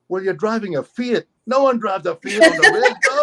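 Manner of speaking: high, amused disbelief; mock a cop baffled by the car, breaking into laughter; incredulous policeman. Policeman questioning a stranger's Fiat, incredulous. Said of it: "this big native policeman goes".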